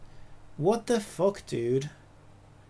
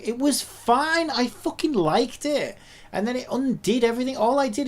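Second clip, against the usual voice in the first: high, raised-voice exasperated protest; 4 semitones higher, 4 dB louder than usual. Real, not acted. User protests the software undid work, high exasperation. Complains about lost work.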